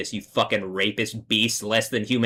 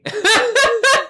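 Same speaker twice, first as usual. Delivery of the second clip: sudden high loud laugh; real amused cackle; 6 dB louder than usual. Real, not acted. Laughs at own idea.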